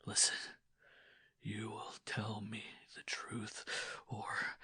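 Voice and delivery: husband threatening his wife in a hoarse whisper. A narrator's reading. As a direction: threaten under the breath. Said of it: "a hoarse whisper".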